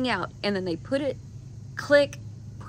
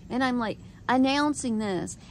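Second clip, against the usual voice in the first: ordinary talk, nothing marked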